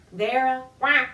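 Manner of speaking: usual speaking voice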